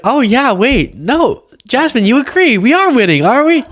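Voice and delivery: high mock-confused voice imitating Margie; a dim, eager colleague who misses the joke. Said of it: "She'll be like".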